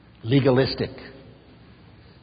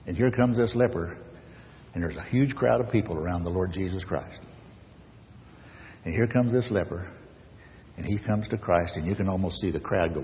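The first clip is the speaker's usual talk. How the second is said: ordinary talk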